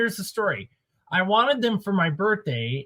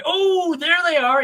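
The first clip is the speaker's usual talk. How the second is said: high, delighted reveal; hammed-up "oh, there it is" discovery; mock surprise; 12 semitones higher, 3 dB louder than usual. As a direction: act surprised to spot them, voice leaping up.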